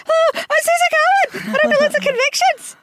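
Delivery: imitating own nerves, very high, loud squeak; hammed-up panicky squeal of a radio intro; nervous high voice. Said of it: "my voice goes higher".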